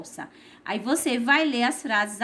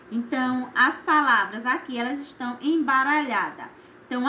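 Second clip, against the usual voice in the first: ordinary talk, nothing marked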